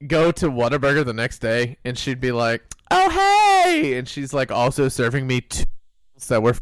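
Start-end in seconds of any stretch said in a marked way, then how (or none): 2.9-4.0 very high, singsong hello; mock-delighted recognition, pitched way up; gushing greeting. Store lady spotting her regular customer, gushing high greeting.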